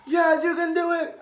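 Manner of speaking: high, loud, mock-crying cheer; impassioned, hammed-up weepy shout; imitated tearful cry. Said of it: "he's crying".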